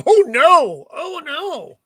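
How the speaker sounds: high, loud mock horror; mock dismay. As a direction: wail it in fake shock. Reacting to comic twist.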